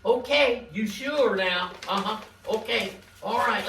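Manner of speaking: ordinary talk, nothing marked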